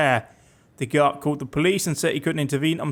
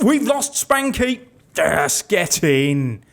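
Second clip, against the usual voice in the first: mock dismay then glee; 3 semitones higher, 3 dB louder, much slower than usual. Real, not acted. Gleeful at losing hated officer.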